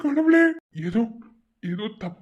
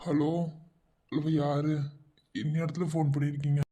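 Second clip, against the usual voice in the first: lower, softer voice through a phone line; over the phone; 7 semitones lower, 8 dB quieter than usual. Acted. Brother-in-law answers a late phone call. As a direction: sound like a voice on the other end of a call.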